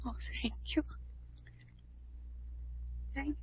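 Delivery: breathless, soft whisper; tearful whispered thank you. Acted. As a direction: barely breathe your gratitude.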